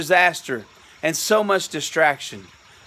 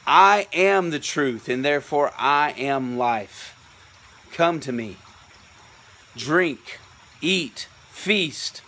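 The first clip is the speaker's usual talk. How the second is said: tender invitation; 5 semitones lower than usual. Retold or imitated. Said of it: "because he says".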